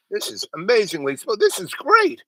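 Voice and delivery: amazed delight. Retold or imitated. Tasting the man's joint without coughing, amazed delight. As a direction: re-enact being blown away, voice shooting up.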